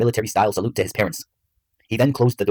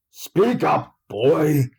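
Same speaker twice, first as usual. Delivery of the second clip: higher, growled Texan command; 7 semitones higher, much slower than usual. A narrator's reading. Father ordering his son to speak up, growling. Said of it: "growled Stephen".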